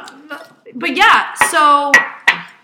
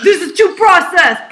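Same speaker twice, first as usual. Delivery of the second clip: mock complaint; 5 semitones higher, 7 dB louder than usual. Acting picky buyer.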